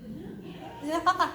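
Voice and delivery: quick soft giggle; short real laugh. Real, not acted. Reader giggling mid-speech, short soft laugh. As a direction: let a small laugh slip.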